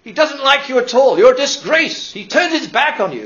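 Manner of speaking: imitating a harsh minister condemning his flock; condemning preacher. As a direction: thunder it at the congregation. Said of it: "this minister was saying".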